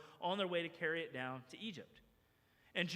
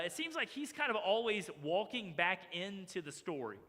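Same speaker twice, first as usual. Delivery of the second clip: ordinary talk, nothing marked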